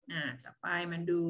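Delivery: ordinary talk, nothing marked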